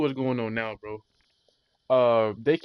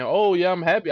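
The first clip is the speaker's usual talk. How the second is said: sarcastic; 5 semitones higher, 8 dB louder than usual. Retold or imitated. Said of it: "I'm not saying".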